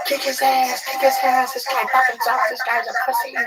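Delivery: high, shrill mock screaming mom; screaming moms impression. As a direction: egg them on in a piercing high mom voice. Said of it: "Their moms are screaming".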